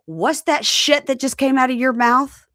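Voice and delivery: high, loud indignant snap; mock furious mom; outraged mother. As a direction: lash out in disbelief. Mother's angry outburst.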